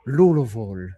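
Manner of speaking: "Louisville" said as lul-uh-vol, a clipped British try; mock British pronunciation. Shown as a demonstration. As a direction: squeeze "Louisville" into three mushy syllables. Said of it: "get them to try to say the word Louisville".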